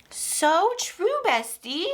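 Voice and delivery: high, gushing squeal of agreement; mock sassy agreement. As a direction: play the cheeky best friend siding with him. Sassy reply to a quoted line.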